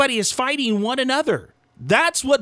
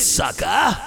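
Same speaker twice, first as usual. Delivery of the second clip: shouted taunt; 5 semitones lower, 4 dB louder than usual. Real, not acted. Outro taunt to listener.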